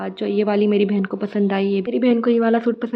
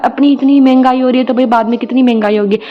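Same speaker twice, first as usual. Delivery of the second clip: loud, raised mock outrage at the price; indignant retort; 3 semitones higher, 11 dB louder than usual. Answering the shopkeeper's inflation excuse, loud playful outrage. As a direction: throw it back at him, half joking but loud.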